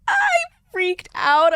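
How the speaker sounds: high, drawn-out excited burst; excited freak-out. Retold or imitated. Young actor reliving news of getting the role, excited high-pitched freak-out. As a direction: relive the thrill in a high, slow squeal. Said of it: "I was so excited".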